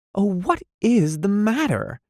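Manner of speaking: pleading to know what's wrong; high, worried voice; distressed. A narrator's reading. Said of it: "cried Muriel in distress".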